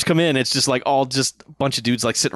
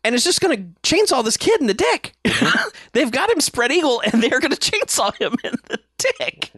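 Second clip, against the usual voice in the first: high-pitched, on the edge of laughter; real amused disbelief; 11 semitones higher than usual. Real, not acted. Podcaster describes an outrageous elf scene to co-host, cracking up. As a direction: tell it incredulously, laughter breaking through.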